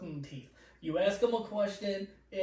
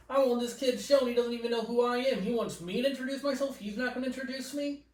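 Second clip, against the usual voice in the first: raised-pitch indignant mock complaint; scornful guest; 6 semitones higher than usual. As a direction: imitate an affronted guest, high and disbelieving.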